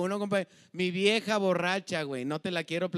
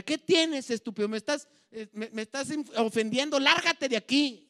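offended scolding; 6 semitones higher, 3 dB louder than usual. Retold or imitated. Offended woman tells him off.

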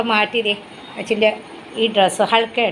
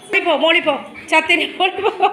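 pet talk; 7 semitones higher than usual. Real, not acted. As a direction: owner cooing to her dog that wants to go out. Shooing dog, laughing.

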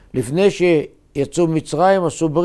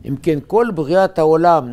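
usual speaking voice

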